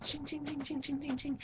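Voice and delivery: soft, high-pitched ching ching; imitated bracelet jingle. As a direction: tinkle it quietly like charm bracelets. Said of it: "they're like".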